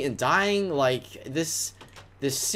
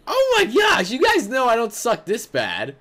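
high, loud exasperated shout; frustrated shout; 6 semitones higher, 5 dB louder than usual. Real, not acted. Gamer telling viewers he doesn't suck, frustrated shout. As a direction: yell it at the game in frustration. Raging at own failure.

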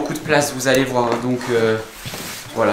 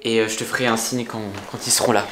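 hushed; 5 semitones lower, 7 dB quieter than usual. Real, not acted. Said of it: "on fait aucun bruit".

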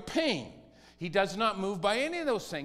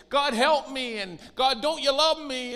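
higher, louder mock pleading; 7 semitones higher, 6 dB louder than usual. Christians begging God for help, mock plea. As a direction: beg God like a desperate believer.

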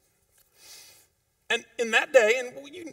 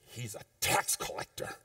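whispered sneer; mock grumble; 9 semitones lower, 8 dB quieter than usual. Preacher voicing the crowd's whispered grumble. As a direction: hiss it like a gossiping churchgoer.

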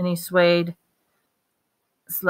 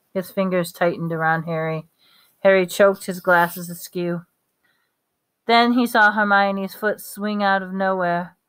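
usual speaking voice

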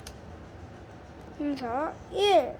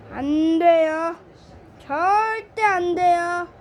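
slow, louder emphatic no; firm no; 7 dB louder, slower than usual. Real, not acted. Host warning children not to throw tantrums, firm. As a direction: drag out each word to forbid it. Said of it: "떼부리지 마세요".

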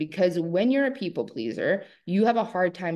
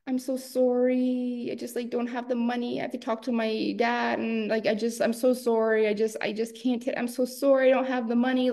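grovel with a little high voice; apologetic people pleaser; 5 semitones higher than usual. Retold or imitated. Woman apologizing to a seller, meek and high-pitched. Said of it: "We're always like".

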